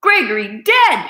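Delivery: loud cry of news; shouted. Acted. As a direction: call out the death. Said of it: "shouted Cook".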